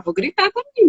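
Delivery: high-pitched imitation of a child; lightly acted defiant child; child's reasoning. Voicing child deciding to yell too.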